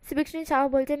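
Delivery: plain reading voice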